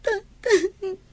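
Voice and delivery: high tearful call; crying. Acted. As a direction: sob out the word. Daughter cries to scolding father. (agsangsangit nga umaw-awag)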